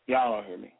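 ordinary talk, nothing marked

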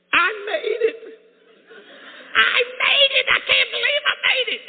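very high, loud jubilant yell; imitating a man beside himself with joy; overjoyed shouting. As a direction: scream it out in amazed triumph. Imitates man arriving overjoyed in heaven.

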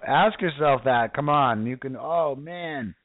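ordinary talk, nothing marked

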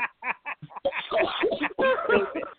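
high burst of real laughter; laughing hard. Real, not acted. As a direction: crack up at the joke. Laughing at the Obama impression.

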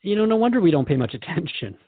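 ordinary talk, nothing marked

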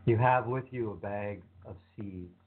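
ordinary talk

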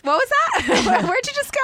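baffled delighted reaction; surprised laugh. Real, not acted.

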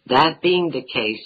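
ordinary talk

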